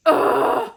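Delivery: loud voiceless groan of disgust; exasperated ugh ending her tea rant. Real, not acted. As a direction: push out a loud, breathy ugh.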